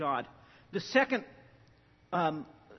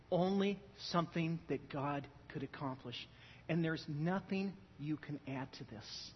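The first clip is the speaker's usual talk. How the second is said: hushed sermon; 4 semitones lower, 8 dB quieter than usual. Acted. Pastor softly telling his church how helpless they were.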